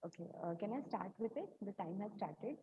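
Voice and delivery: faint, far from the mic; distant, too quiet to hear; barely audible. Real, not acted. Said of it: "Your voice is very low".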